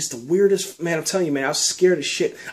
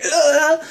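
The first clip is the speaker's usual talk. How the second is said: pained groan; 12 semitones higher, 5 dB louder than usual. Retold or imitated. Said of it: "I'm feeling like I'm on fire".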